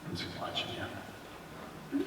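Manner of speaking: whispered aside, slow; hushed, watchful whisper. Real, not acted. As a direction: barely breathe the words while watching. Watching the volunteer sway on stage.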